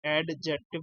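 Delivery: एडजेक्टिव with an audible D before the J; mispronounced adjective. Shown as a demonstration. Teacher quoting students' wrong way, एडजेक्टिव with the D pronounced. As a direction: sound the silent D in एडजेक्टिव, "ad-jective". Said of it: "गलत बोलते हो".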